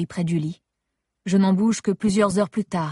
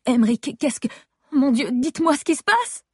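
panicked pitch climb; 7 semitones higher than usual. Acted. Wife begging staff as her husband's alarms go off, voice rising high in panic. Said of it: "Ma voix a grimpé d'une octave".

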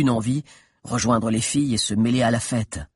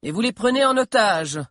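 higher raised call; crying out; 11 semitones higher than usual. Acted. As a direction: call down from the balcony. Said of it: "cria Ogenor".

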